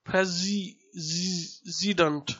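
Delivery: Presi- zi- zident, stressing the z; broken into syllables. Shown as a demonstration. Teacher breaking down president for the class, syllable by syllable. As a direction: split president, lean on zi. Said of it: "S-, z".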